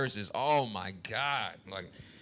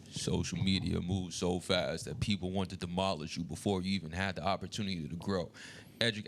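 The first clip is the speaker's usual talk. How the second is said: [name] impression; 8 semitones lower than usual. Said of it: "I'm gonna read it like the baby".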